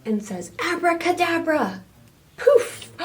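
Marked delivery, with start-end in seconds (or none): 0.6-1.8 high, grand abracadabra; magician's spell. Acted. 2.4-2.9 very high breathy poof; magic poof. Acted. Rabbit vanishes.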